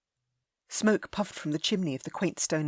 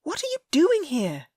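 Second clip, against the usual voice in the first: put-on high young lady, startled and worried; a raised, anxious young woman's voice; Ellie, alarmed; 11 semitones higher, 6 dB louder than usual. A narrator's reading.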